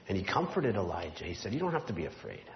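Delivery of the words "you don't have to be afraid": softer, reassuring God voice; gentle comfort. Acted. God comforting Elijah, soft and gentle. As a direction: comfort a frightened friend. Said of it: "he whispered quietly, gently, lovingly".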